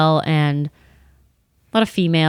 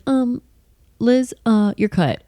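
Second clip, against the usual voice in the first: lightly voiced dopey manager, higher and hesitant; mock the boss gently as he fires her; Tim's voice; 6 semitones higher, slower than usual. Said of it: "kinda talks like this".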